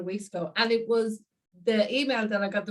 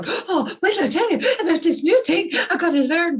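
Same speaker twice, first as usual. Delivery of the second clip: much higher and louder, laughing through it; overexcited; 9 semitones higher, 8 dB louder than usual. Retold or imitated. Overwhelming listeners with a new discovery, much higher, louder and laughing. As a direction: burst out with breathless glee. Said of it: "that kind of excitement in my voice".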